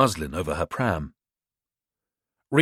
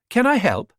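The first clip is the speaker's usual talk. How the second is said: put-on higher posh English accent; crisp, well-bred English lady; 12 semitones higher, 4 dB louder than usual. Young mother answering a stranger, posh English accent. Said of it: "She had a posh English accent".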